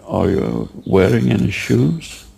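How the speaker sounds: normal talking voice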